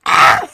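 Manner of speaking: short, loud shriek of joy; real scream of delight; excited scream. Real, not acted. First subscriber arrives.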